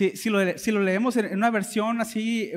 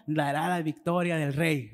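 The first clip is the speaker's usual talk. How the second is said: a hushed, sarcastic yes-man reply; 3 semitones lower, 6 dB quieter than usual. Acted. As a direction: drag it out with quiet irony. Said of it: "le respondió con sarcasmo".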